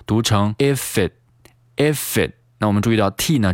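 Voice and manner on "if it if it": "if it" as "i-fit"; slow joined "if it"; linked reading. Shown as a demonstration. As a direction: carry f of "if" into "it". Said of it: "再次进行连读".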